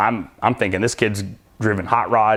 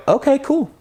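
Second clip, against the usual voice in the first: higher-pitched, straight-faced acceptance; feigned composure; 13 semitones higher than usual. Retold or imitated. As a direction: play it cool, lifting the pitch at the end. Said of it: "straight face, I go".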